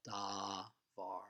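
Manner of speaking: "davar" with a long, drawn-out "da"; long first vowel. Shown as a demonstration. Teacher showing the long vowel under the dalet, "davar" drawn out. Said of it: "so it's a long vowel".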